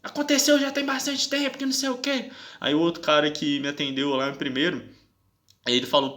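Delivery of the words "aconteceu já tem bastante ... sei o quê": high, loud imitation of an irritated policewoman; mock agitated complaint. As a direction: mimic her indignant griping way up high. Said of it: "já saiu nervosa".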